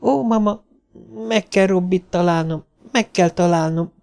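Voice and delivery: put-on high child's plea to mama; little girl near tears; tearful pleading. A narrator's reading. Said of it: "patakzani kezdtek a könnyei".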